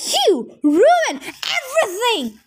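slow, high, furious yell; angry yell. A narrator's reading. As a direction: blast him with the blame. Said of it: "she yelled at me".

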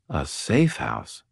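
slow, thoughtful murmur; pondering aloud slowly; musing. A narrator's reading. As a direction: muse on it, drawing it out. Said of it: "I mused".

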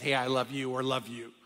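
quiet, easy "love you"; a gentle habit of ending the call; soft sign-off to wife. Retold or imitated. Ending every call with his wife, softly. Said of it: "I always end the conversation with".

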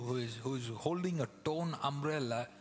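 normal talking voice

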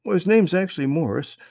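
put-on worried boy explaining they're lost; young boy voice. A narrator's reading. Brother explains to Marvin they lost the string, high boy voice. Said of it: "said Trey".

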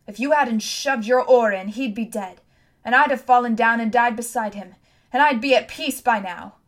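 loud, forceful accusation; angry blame. A narrator's reading. Girl turning to face boy who stopped her revenge, angry. Blaming Tom for her failure.

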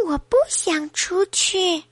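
put-on high, slow baby voice; little one dragging out a refusal; small high-pitched voice of a baby. Storyteller voices the unborn baby.

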